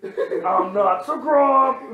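high, long drawn-out mock call; drawn-out catchphrase. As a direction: stretch it out like a silly catchphrase. Playful call-back to the shirt catchphrase.

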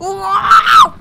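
surprised shout. Real, not acted. Loud shout in game.

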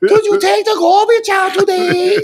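high laughing mom voice; mock mom. Teasing Timmy as his mom, high and laughing. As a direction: nag like a sitcom mother while cracking up.

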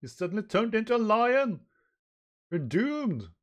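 high, loud panicked wail; Nami. Acted. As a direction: shriek in mock terror. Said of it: "Now Nami goes".

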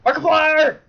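high, very loud yell; shouted name. Pretend. Player quoting famous last words, high yell. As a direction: bellow the name out like a cry.

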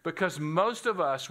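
usual speaking voice

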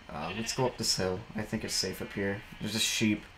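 usual speaking voice

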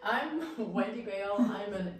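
normal talking voice

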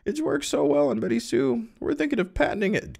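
very high put-on falsetto voice; high-pitched character. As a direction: read it way up in a squeaky-high character voice.

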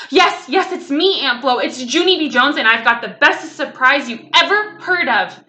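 put-on breathless-with-news little girl, emphatic; excited child. A narrator's reading. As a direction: burst out eagerly with a big surprise. Child gushing surprise to aunt.

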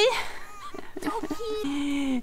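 high, drawn-out villain laugh; high squealing put-on laugh; high mock cackle. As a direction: cackle after the taunt. Villainous cackle after the taunt.

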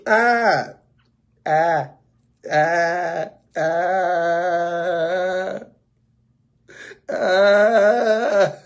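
drawn-out mock "ah" of pain; mock pained groans. Customer hinting the braider should stop, long pained ahs. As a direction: groan as a hint to stop pulling.

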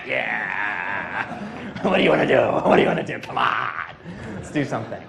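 low, pushy paperclip voice; hammed-up eager Clippy nagging; Steve Ballmer as Clippy. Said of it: "Steve Ballmer in paperclip form".

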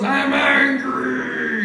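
high, very slow, put-on angry voice; mock angry guy. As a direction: drag it out in a silly voice.

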